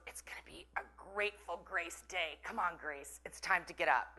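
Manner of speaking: soft, whispered cheery wake-up. Retold or imitated. Whispering to Grace to wake up, softly sing-song. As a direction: whisper it like tiptoeing in. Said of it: "he'd come in and he goes".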